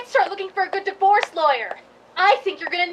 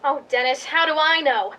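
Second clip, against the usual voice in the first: ordinary talk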